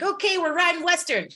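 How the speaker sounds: high, loud, chipper call; high-pitched mock-cheery instruction; imitated upbeat announcement. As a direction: announce it brightly as the crew, mimicking. Crew announces Western riding to her.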